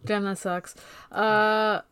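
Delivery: ordinary talk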